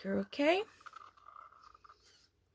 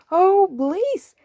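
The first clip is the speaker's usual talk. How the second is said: much higher, louder happy plea; eager cow; 8 semitones higher, 8 dB louder than usual. Acted. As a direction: beg eagerly like a cow wanting the bath. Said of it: "says the cow".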